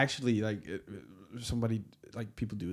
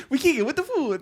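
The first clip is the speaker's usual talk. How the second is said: high put-on voice, laughing along; mock European voice; 12 semitones higher than usual. Echoing the joke to her friend in a high mock voice. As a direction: copy the caricature voice back at her.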